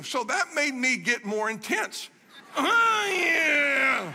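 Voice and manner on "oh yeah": a high, loud cry of strain as the weight lands; sudden loud cry, half laugh, half strain; straining shout. Real, not acted. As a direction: yell out from the effort of holding him up. Straining under a heavy man's hug.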